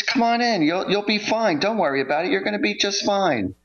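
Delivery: reassuring host. Retold or imitated. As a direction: mimic the host cheerfully telling her not to worry. Host reassuring her, quoted.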